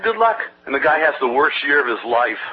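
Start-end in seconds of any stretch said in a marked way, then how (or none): 0.0-0.4 dismissive good luck. Retold or imitated. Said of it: "I said".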